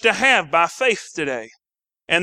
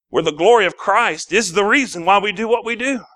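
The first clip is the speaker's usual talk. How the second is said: ordinary talk, nothing marked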